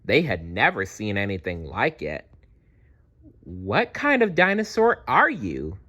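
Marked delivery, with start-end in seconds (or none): 3.4-5.8 curious dinosaurs. Acted. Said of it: "they asked".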